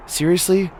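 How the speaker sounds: ordinary talk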